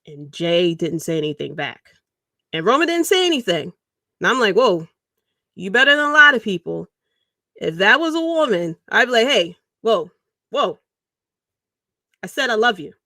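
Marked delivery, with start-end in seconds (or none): 4.6-12.9 louder, offended "whoa" at being ignored; mock indignant. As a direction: act stung that nobody answered. Imagined reaction to silent reply.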